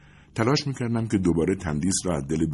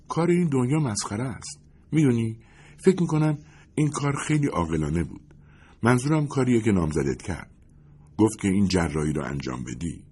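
slightly higher character voice, confiding in a friend; lightly voiced Lindi, earnest; 5 semitones higher than usual. A narrator's reading.

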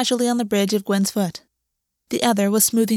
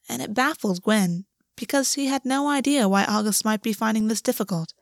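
usual speaking voice

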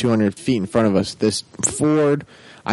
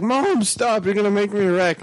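higher, urgent pleading; frantic plea; 9 semitones higher than usual. Retold or imitated. Son driving in the storm begging mom to let go, higher alarmed plea. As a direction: beg her to stop, pitched up in alarm. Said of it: "I was just like".

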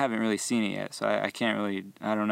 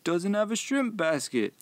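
high, pushy disbelieving customer voice; higher mock outrage, faster in the middle; indignant customer; 8 semitones higher than usual. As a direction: play the baffled customer who expects shrimp. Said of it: "And he was like".

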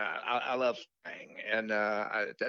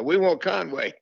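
raised, higher-pitched heckler call; mock audience shout, louder and higher; imitated crowd call; 7 semitones higher, 6 dB louder, slower than usual. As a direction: call out like a few impatient concertgoers. Mimics a few hecklers in crowd.

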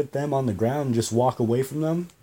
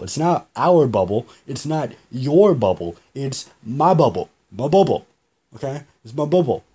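insistent; 5 dB louder than usual. Retold or imitated. Telling the approaching man, a loud insistent protest. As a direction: insist on it, louder each time.

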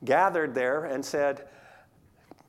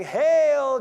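loud, high mock salute; mocking hail; 7 semitones higher, 9 dB louder than usual. Soldiers jeering the prisoner, a loud mock hail. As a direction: jeer it like a soldier.

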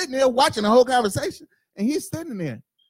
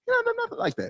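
much higher, softer quoted voice; imitating teachers; 13 semitones higher, 7 dB quieter than usual. Mother quotes her kid's teachers, high soft imitation. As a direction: mimic a teacher high and quiet. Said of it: "every fucking teacher up there says".

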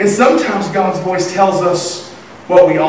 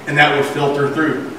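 normal talking voice

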